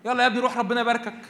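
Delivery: offhand father's blessing; casual dismissive father. Pretend. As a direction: brush the son off lightly. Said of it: "بارك ولاده يعني إيه".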